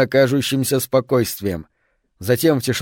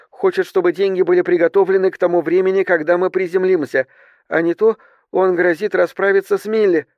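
higher-pitched, lightly voiced stewardess; narrator's higher voice for a flight attendant; stewardess reporting demands; 5 semitones higher than usual. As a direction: relay the threats in a raised, careful voice.

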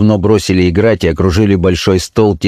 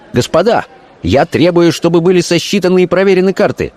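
high, urgent indignation; indignant demand; 9 semitones higher than usual. A narrator's reading. Young officer demanding the cards be counted, indignant. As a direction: demand it heatedly, pitched high. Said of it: "глаза его горели негодованием".

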